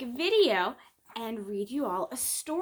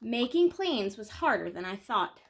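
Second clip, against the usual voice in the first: gentle picture-book read-aloud, lingering on key moments; storytelling. Acted. Reading a children's book to her viewers, gentle storytelling. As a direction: tell it like a bedtime story for kids.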